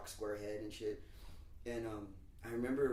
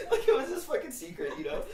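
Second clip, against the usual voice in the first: high, loud, cracking up on the words; real laughing; 13 semitones higher, 10 dB louder than usual. Real, not acted. Teller explaining the secret tape, laughing hard. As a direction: talk through loud laughter.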